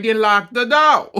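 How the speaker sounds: loud, high, indignant mock yell; mom yelling. Mom yelling at her daughter to lock the door, indignant shout. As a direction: belt it like a scandalized mom. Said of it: "her mom was like".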